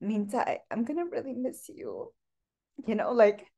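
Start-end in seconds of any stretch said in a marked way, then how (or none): 0.0-2.1 gentle, hushed motherly voice; a daughter softly voicing her mother's goodbye; soft imitation of mom. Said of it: "she was like".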